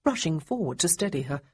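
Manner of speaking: ordinary delivery, nothing marked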